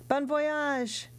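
called out high after him; shouted farewell. A narrator's reading. Danglars calling after Edmond as he leaves, shouting. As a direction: shout it after the departing sailor. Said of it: "he shouted".

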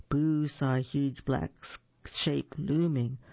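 ordinary delivery, nothing marked

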